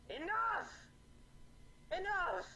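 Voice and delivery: very high-pitched shrieked outburst; shrill female shriek. Acted. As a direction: shriek it out like an enraged woman. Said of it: "hear a shrill female voice just shriek".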